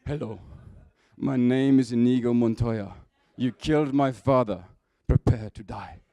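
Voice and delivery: put-on loud, declaimed avenger's challenge, no accent; Inigo Montoya. Preacher quoting The Princess Bride, in a booming vengeful voice. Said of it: "I don't think I'm gonna do the accent for this".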